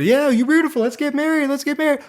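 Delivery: hammed-up eager suitor, very high; over-eager. Prince proposes to the princess, over-eager. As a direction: rush into it with mock giddy excitement.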